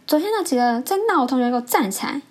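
mock stern command; very angry teacher. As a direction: imitate a teacher ordering troublemakers up. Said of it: "就非常的生气".

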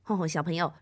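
usual speaking voice

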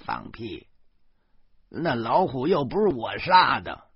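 lightly voiced old woman snapping back; a hint of a stubborn old mum; old mother retorting. Old mother shooting down her son, lightly voiced and indignant.

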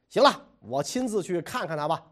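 ordinary delivery

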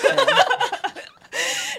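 long high laugh falling off; loud high laugh. Real, not acted. Laughing at the drinking memory. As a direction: let a big laugh roll down.

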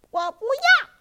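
high, loud shout of refusal; a child shouting no. Acted. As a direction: yell it out stubbornly. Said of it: "艾莉大声地说".

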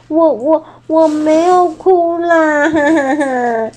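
a proud uncle hiding tears; tearful denial. Acted. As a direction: insist you're not crying while sniffling. T-rex denies crying.

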